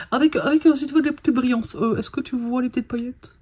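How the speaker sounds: tired, high, sing-song rambling; odd voice. Real, not acted. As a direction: drift into a strange high little voice. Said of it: "Je sais pas pourquoi je parle comme ça".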